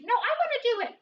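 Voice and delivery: high, insistent squeak; squeaky mouse demanding her turn; Minnie Mouse's regular voice. Acted. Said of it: "this is my regular voice".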